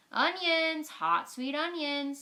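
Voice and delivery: loud, high street vendor's cry; loud slow vendor call. A narrator's reading. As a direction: hawk onions down the street. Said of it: "Sam called out on the street".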